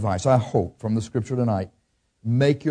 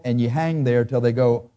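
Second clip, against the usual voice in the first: ordinary talk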